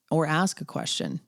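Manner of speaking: ordinary delivery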